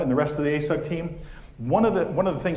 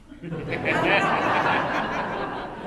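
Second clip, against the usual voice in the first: breathy laugh; 3 semitones higher than usual. Real, not acted. Speaker laughs at son's sales joke, breathy.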